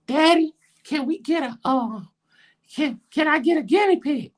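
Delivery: put-on very high-pitched little girl voice; imitating a kid begging dad; daughter's voice. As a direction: plead in a tiny, high child voice.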